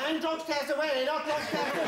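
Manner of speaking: high-pitched mimicked boxer; boxer's retort. Boxer saying judges need drug tests, high mimicked protest. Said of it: "He said".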